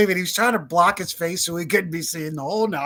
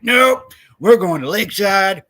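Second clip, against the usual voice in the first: loud, higher-pitched mock-firm refusal; emphatic refusal; 4 semitones higher, 9 dB louder than usual. Retelling his refusal to enter that parking lot, emphatic and loud. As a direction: punch it out loud, retelling a hasty retreat. Retold brisk refusal to waiting cops.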